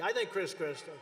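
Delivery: ordinary delivery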